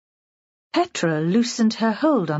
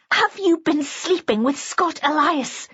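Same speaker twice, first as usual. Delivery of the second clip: higher, insistent interrogation; accusing; 6 semitones higher than usual. A narrator's reading. Petra repeats her question to the narrator, higher insistent accusation. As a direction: press the accusation in a raised pitch. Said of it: "she repeated".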